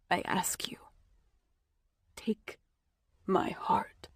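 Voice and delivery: hushed, breathless whisper; a dying man's whispered plea. Acted. Merrick pleads in a whisper.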